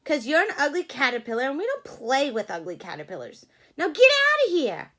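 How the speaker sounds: fast, then loud high shooing away; mean rabbits. Acted. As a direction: jeer and drive him off. Rabbits chasing Charlie away.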